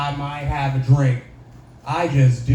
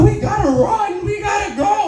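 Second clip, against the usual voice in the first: high-pitched panicked wail, imitating sister; hammed-up tearful squeal; mock crying. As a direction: wail it like a crying kid begging to flee. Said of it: "And she started crying".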